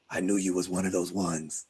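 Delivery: very low, hushed elder's voice; Baba's voice. Retold or imitated. Baba confiding in the newcomer, deep quiet voice. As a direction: drop deep and soft, all on one note. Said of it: "That's how he talked".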